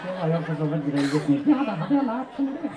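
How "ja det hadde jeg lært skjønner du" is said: high, cheeky ventriloquist puppet voice; puppet character. Acted. Puppet correcting its handler, high comic voice. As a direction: pipe up higher, like a comic puppet.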